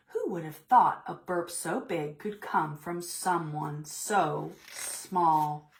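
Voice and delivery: ordinary delivery, nothing marked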